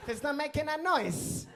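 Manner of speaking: high, slow, laughing protest; high slow mock plea. Arguing that the silent letter makes no sound, high mock plea.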